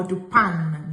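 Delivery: "pain" said like "pan", N pronounced; mispronounced "pain" with an English-like "an"; wrong sounded N. Shown as a demonstration. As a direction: drop low and end "pain" on a full N.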